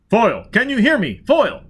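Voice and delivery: loud high call over the radio. Acted. Dagenham hailing Foyle over the radio, loud. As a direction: shout his name to reach him.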